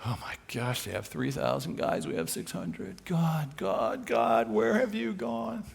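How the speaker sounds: hushed, panicky fretting, mock despairing. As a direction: whimper like a frightened king in bed. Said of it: "He's not laying in bed going".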